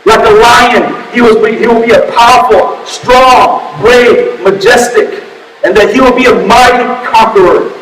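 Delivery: high-pitched, urgent sermon delivery; impassioned preaching. Real, not acted. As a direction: preach it forcefully, warning of doom. Preaches at full force.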